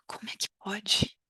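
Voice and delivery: whispered, almost no voice. Shown as a demonstration. Teacher whispers the phrase to her students. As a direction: breathe it out as a secret aside.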